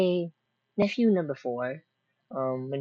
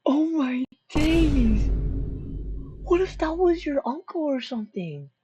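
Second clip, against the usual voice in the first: shocked; 10 semitones higher, 5 dB louder than usual. Real, not acted. Shocked at the bat attack.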